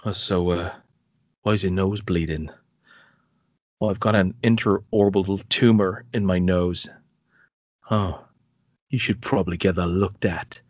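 low, flat mock dialogue between two men; mocking a bizarre film scene in a low, deadpan voice.

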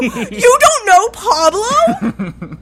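high, loud, drawn-out disbelief; mock incredulity. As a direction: wail it as if utterly scandalised. Acted incredulous reply in story.

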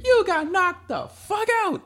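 very high, slow, gleeful mock line; high, drawn-out movie quote, teasing; Smokey from Friday. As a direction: stretch it out laughing at the knockout. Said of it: "reminded me of Smokey from Friday".

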